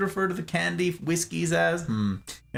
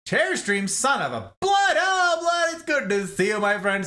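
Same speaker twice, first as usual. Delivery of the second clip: excited greeting; 8 semitones higher than usual. Real, not acted. Streamer welcoming Blood into chat, high excited greeting. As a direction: greet a friend with delight.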